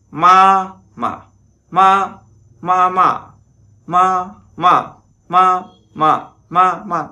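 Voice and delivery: "Ma, mà" pitched high and loud, first light, second falling; teacher repeating "ma, mà" high and loud for the class; Mandarin tones on ma. Shown as a demonstration. Teacher modelling the question particle ma, high and loud. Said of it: "Cái từ ma này".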